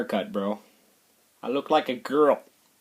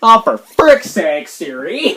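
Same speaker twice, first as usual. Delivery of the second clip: fed-up outburst; 3 semitones higher, 7 dB louder, slower than usual. Real, not acted. Vlogger losing patience with Siri, loud drawn-out exasperation. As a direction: stretch it out, utterly fed up. Arguing with the phone assistant.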